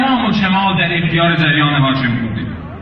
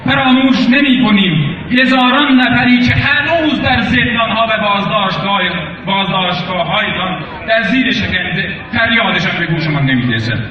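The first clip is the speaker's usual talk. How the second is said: rally speech; 4 semitones higher than usual. Real, not acted. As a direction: declaim each line to the crowd. Impassioned protest speech at university.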